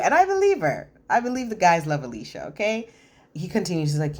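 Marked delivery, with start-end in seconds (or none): 0.0-2.9 excited. Real, not acted. Excited aside.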